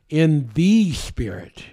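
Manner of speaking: THE held long before "Spirit"; slow down and lean on "the"; drawn-out, definite THE; stress on THE. Shown as a demonstration. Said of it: "That's a definite article".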